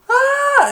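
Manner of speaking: very high loud shrieking scream; panicked scream. Retold or imitated. Ted shrieking as he runs, very high scream. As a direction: let out a terrified shriek.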